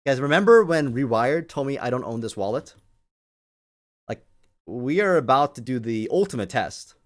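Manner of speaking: normal talking voice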